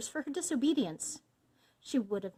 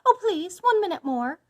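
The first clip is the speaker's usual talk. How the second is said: high, loud begging; desperate wife's raised voice; called-out plea; 10 semitones higher, 9 dB louder than usual. A narrator's reading. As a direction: call back pleadingly for more time. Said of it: "called out his wife".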